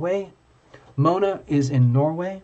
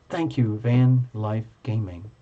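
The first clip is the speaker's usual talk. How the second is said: ordinary talk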